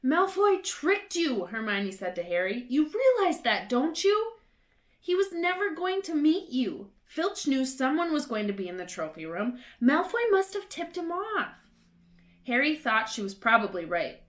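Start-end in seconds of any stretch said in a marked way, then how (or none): 0.0-1.5 put-on higher, slow, know-it-all girl; a bossy young witch setting him straight; Hermione's voice. A narrator's reading. Hermione scolds Harry. 2.7-11.5 higher, bossy schoolgirl voice; Hermione's voice. A narrator's reading. Hermione explains to Harry.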